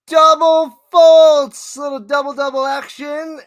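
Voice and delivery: very high, loud holler; excited fault call. Real, not acted. Commentator celebrating a double fault, excited shout. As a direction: belt it out high, stretching the fault.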